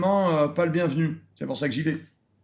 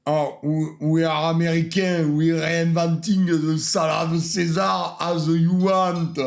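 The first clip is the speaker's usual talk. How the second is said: mock American accent; slower than usual. Mocking Americans reinventing the dish.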